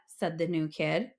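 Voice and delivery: ordinary delivery, nothing marked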